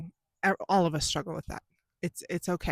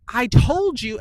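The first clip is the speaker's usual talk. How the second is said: loud, high outburst on TOLD; losing it; 7 semitones higher, 11 dB louder, slower than usual. Retold or imitated. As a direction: snap into an exasperated parent's yell. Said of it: "then you're screaming".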